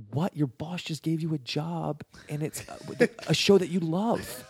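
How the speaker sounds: high, slow incredulous disbelief; can't believe what you're hearing. Retold or imitated. Watching a coworker turn down a job, incredulous. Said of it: "And I was sitting here like".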